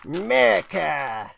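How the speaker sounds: hammed-up Southern accent, held back on purpose; mock Southern accent. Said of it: "Trying not to do a Southern accent there".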